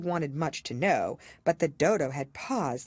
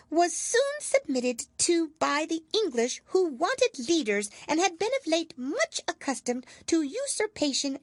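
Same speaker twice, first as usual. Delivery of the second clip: self-important mouse; 13 semitones higher, 4 dB louder than usual. A narrator's reading. Mouse recites dry history to dry everyone, pompous. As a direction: lecture like a tiny schoolmaster. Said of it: "with an important air".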